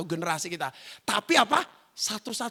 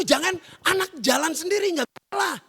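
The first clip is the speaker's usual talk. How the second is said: usual speaking voice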